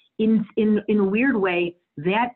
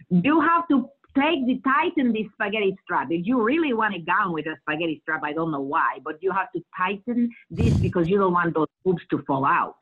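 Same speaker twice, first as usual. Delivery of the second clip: imitating her Dominican mother's accent, pitched up at the start. Improvising her mother fitting a bride's gown, Dominican-accented imitation. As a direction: mimic a Dominican seamstress fussing over a bride. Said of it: "the things that I heard my mother saying".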